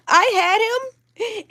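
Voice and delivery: high, very slow, drawn-out lament; tearful. Real, not acted. Telling viewers of the lost baby, tearfully. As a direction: wail it slowly, near tears.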